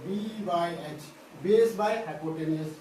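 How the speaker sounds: very slow, each word separated for note-taking; slow dictating teacher; slow dictation. Shown as a demonstration. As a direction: drag it out so they can write it down. Teacher dictating formula to students.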